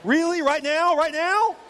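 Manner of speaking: high, loud incredulous outburst; exasperated disbelief. Retold or imitated. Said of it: "You're like".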